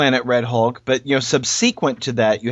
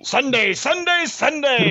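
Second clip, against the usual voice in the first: rising hyped announcer call; monster truck ad; 13 semitones higher, slower than usual. Retold or imitated. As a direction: shout it like a rally promo. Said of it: "monster truck announcer".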